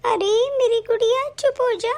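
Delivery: ordinary talk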